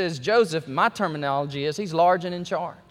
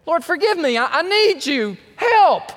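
louder, higher, voice cracking into a cry; pleading cry; 8 semitones higher, 5 dB louder than usual. Acted. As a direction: cry out for help, breaking. Acts a desperate sinner's plea.

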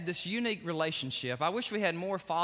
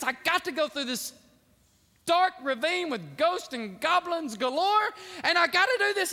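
fearful; 13 semitones higher, 9 dB louder than usual. Retold or imitated. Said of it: "And he's just fearful".

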